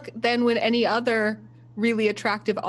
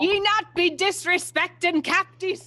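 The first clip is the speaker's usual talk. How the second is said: raised, higher pirate voice; in-character pirate rebuke; indignant pirate; 8 semitones higher, 3 dB louder than usual. Acted. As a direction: scold like an affronted pirate crew member. Pirate crew defends their captain in game.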